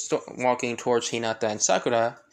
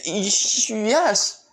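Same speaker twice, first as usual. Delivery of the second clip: high, loud flustered stammer; stuttering; 7 semitones higher, 5 dB louder, much slower than usual. Acted. Hinata accepts Naruto's date invitation, stuttering. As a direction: trip on the first sound, then push it out. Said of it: "like, you know, stuttering".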